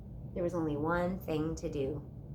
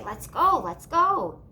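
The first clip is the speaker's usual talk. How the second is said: impatient reindeer; 5 semitones higher, 6 dB louder, slower than usual. A narrator's reading. Reindeer as if saying.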